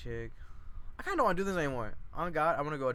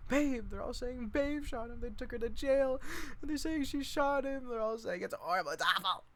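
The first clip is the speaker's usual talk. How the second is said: much higher, fretful voice; dismayed gossip; 12 semitones higher than usual. Acted. Lenny telling Meg the news, high and fretful. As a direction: wring your hands as you tell it.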